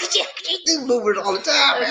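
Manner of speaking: high, laughing words; real laughter while teasing her. Real, not acted. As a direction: talk through the giggles. Laughing about bloopers.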